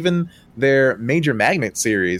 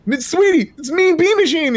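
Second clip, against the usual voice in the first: very high, giddy calling out; retold excited yelling; 3 dB louder than usual. Gamer recalls yelling at Serena about Mean Bean Machine, giddy. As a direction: imitate yourself yelling the big news, voice way up. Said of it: "I'm yelling at Serena like".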